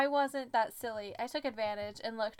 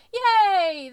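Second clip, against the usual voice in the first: high, loud cheer; happy shout; 9 semitones higher, 14 dB louder than usual. A narrator's reading. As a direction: cheer with childlike glee. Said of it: "shouted happily".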